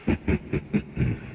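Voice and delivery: soft laugh. Acted. Villain amused by accusation.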